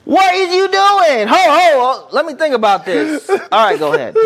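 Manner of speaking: loud, high mock protest breaking into laughter; startled stranger. Acts out startled stranger's reaction.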